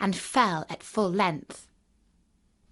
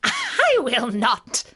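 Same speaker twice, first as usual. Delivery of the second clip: slow, higher witch's refusal; retorting witch; 4 semitones higher, 4 dB louder, much slower than usual. A narrator's reading. Witch refusing Dorothy her shoe, defiant retort. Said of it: "retorted the witch".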